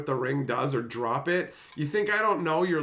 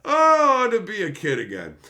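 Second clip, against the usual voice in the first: a big high burst of nostalgia, then settling; loud, high "oh" of longing; wistful exclamation; 7 semitones higher, 8 dB louder than usual. Real, not acted. Nostalgic exclamation about childhood.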